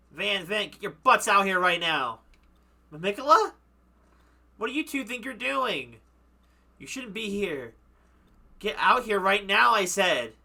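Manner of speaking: angry calling out. Acted. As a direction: call the two out of the house furious.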